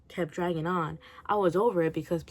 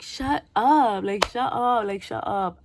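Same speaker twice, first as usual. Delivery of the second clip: higher, louder exasperated retort, fading; exasperated shut up; 3 semitones higher, 6 dB louder, slower than usual. Retold or imitated. Reader retorting at Polly in the book, exasperated and loud. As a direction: snap back in disbelief, then trail off. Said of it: "And so I was like".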